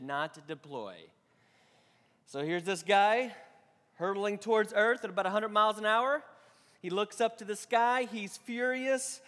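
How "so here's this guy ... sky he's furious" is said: storytelling build. Acted. Building joke to punchline.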